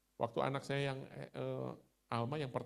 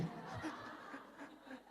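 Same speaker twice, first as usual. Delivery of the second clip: high, soft airy laugh; quiet airy laugh at the joke; breathy laugh; 12 semitones higher, 8 dB quieter than usual. Real, not acted. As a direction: let the laugh escape, amused. Laughs after teasing quote.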